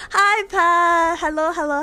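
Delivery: usual speaking voice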